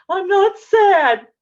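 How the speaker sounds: put-on high, loud child voice insisting; child's protest. Teacher mimics the crying child correcting her, high and loud. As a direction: squeal it back like an upset little kid.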